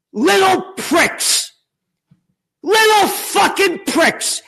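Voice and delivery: high, angry shouting; furious rant. Real, not acted. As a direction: rail at them, drawing out the insults. Furious rant about Epstein.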